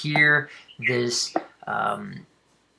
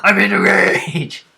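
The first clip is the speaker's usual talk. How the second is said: loud, raised-pitch imitation of a rage shout; mock battle cry; 5 semitones higher, 10 dB louder than usual. Game master echoes the player's rage, shouting it. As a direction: echo a warrior's yell at full volume.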